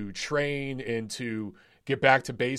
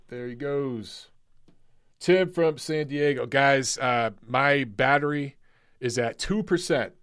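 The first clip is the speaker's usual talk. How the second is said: usual speaking voice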